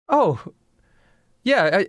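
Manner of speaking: high-pitched, halting reply; sheepish Hemlock. Acted. Hemlock owns up to rescuing him, hesitant. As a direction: admit it after a long pause. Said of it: "And Hemlock goes".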